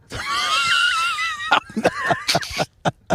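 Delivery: a high squealing burst of laughter; shrieking laugh. Real, not acted. Cohost cracks up at the truck stop sundress joke, shrieking laugh.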